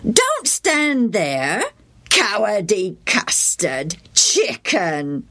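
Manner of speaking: slow, drawn-out schoolboy taunt; string out the insult to sting; mocking child's singsong; jeering boys. A narrator's reading.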